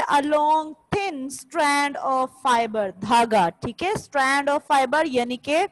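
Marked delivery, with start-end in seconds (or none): 0.0-2.9 very slow, every word spaced for copying; slow dictation. Shown as a demonstration. Teacher dictating a definition to students, very slowly. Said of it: "इसका क्या मतलब होता है?".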